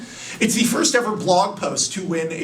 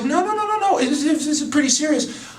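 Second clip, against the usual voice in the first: panicked protest; 8 semitones higher than usual. Acted. Clark insisting to Lois he's serious, high and panicky. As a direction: voice shoots up high in a frantic denial.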